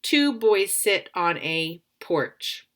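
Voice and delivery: slow, careful read-aloud of the sentence; slow trial reading. Shown as a demonstration. Teacher slowly reads the sentence to test the word. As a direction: test how Two boys sit on a porch sounds, slowly. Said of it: "I'm gonna use the first word".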